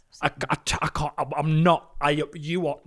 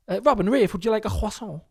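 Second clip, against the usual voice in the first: higher-pitched, try-hard French "croissant"; put-on French accent; 6 semitones higher than usual. Parent offers children breakfast, affected French accent. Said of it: "trying to say it in the right accent".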